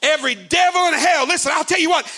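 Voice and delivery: high, loud shouted preaching; full-force preaching. Acted. Preacher declaring to the demons in the community, shouting. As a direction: preach it hard over the music.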